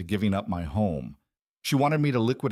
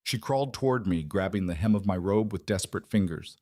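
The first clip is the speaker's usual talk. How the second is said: ordinary delivery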